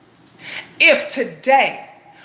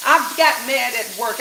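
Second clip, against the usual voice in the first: normal talking voice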